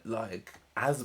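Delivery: usual speaking voice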